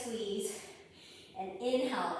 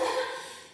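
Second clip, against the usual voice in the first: breathless laugh; 13 semitones higher than usual. Real, not acted. Laughs at being winded.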